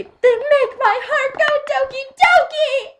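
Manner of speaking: very high, loud squeal of mock adoration; gushing fan squeal. Fan gushing on purpose about Iceland's eyes, high loud squeal. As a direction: squeal it like an over-the-top smitten fan.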